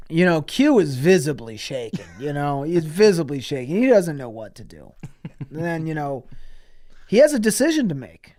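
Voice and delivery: usual speaking voice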